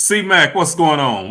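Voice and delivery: loud, high shout-out to a friend; big happy hello across the room; excited greeting. Real, not acted. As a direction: call his name out with a burst of joy.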